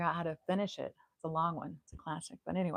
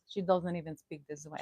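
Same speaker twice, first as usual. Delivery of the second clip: normal talking voice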